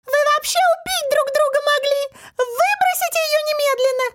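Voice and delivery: very high, piercing exclamation; urgent tiny-creature squeal; Масик. A narrator's reading. Little man warns friends about the herb, shrill squeak. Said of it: "голосок тоненький и пронзительный".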